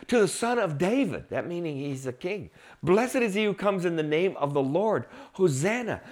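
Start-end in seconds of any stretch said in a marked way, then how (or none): 0.1-1.3 higher-pitched acclaim of a crowd; high, ringing retold cry; retold crowd cheer. 2.8-6.0 raised-pitch acclamation; mild retold shout, pitched up; retold crowd cheer.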